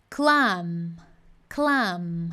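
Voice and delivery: clam with a closed a and m. Shown as a demonstration. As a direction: say clam, lips shut on m. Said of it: "Azinho fechado".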